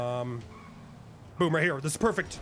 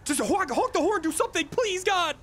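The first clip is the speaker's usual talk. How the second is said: high-pitched frantic pleading; desperate exasperation; 7 semitones higher than usual. Real, not acted. Boss begging teammate to honk, desperate.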